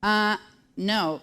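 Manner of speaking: normal talking voice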